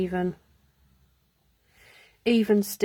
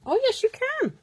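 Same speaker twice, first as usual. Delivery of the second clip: delighted surprise. Real, not acted.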